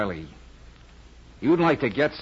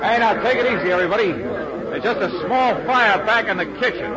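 raised, higher voice to a crowd; urgent public announcement, pitched up; calling out; 8 semitones higher, 3 dB louder than usual. Acted. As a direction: call over the commotion to keep order. Calming a crowd during fire alarm.

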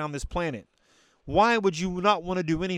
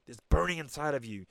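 ordinary talk, nothing marked

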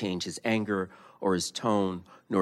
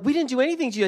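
waiters protesting; 7 dB louder, much faster than usual. Retold or imitated. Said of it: "they're like".

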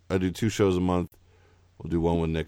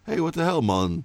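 higher-pitched fake Jamaican, dropping on "mon"; mock Jamaican accent; 8 semitones higher than usual. Host mimicking the actor's fake Jamaica, mock Jamaican accent. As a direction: put on a bad Jamaican accent. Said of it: "put the word mon at the end".

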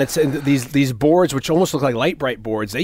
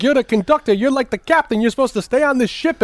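mock scolding; 11 semitones higher than usual. Boss scolding the conductor for leaving, higher. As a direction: tell off the conductor.

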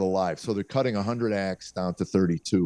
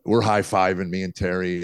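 normal talking voice

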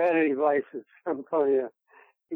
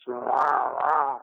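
gravel voice; 2 semitones lower than usual. Retold or imitated. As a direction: growl it like an old wrestler. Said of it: "He had that gravel voice".

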